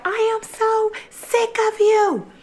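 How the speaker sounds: very high, whiny mock scream; squealed, fed-up imitation; imitated crying scream. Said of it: "screaming and crying at the same time".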